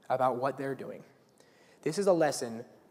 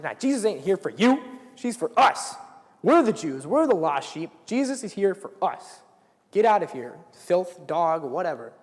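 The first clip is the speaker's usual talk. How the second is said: jeering shout; 2 semitones higher, 7 dB louder than usual. Retold or imitated. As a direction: drive her off with mockery. Disciples voiced jeering at the woman.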